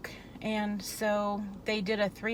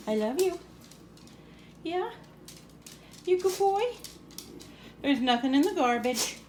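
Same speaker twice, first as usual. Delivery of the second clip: talking to a dog; 3 semitones higher than usual. Real, not acted. Talking to her dog Cooper.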